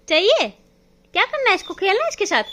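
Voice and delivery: high, loud questions to the puppy; high pet-talk questions; talking to a dog. Real, not acted. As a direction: tease the dog with the doll. Cooing at dog.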